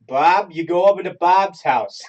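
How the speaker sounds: Bob as Bahb, Boston accent; loud, slow Boston accent, laughing. Shown as a demonstration. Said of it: "how do you say Bob and Barb".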